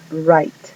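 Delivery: Right as "rait", W silent; English word write. Shown as a demonstration. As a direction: drop the W. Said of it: "La W no suena". ("Right" sin W)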